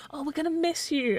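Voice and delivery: high-pitched cooing imitation of colleagues; mock farewell gushing. Mimics colleagues' farewell, then rejects it.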